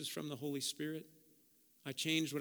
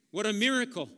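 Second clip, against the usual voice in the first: excited exclaim; 6 semitones higher, 9 dB louder than usual. Real, not acted.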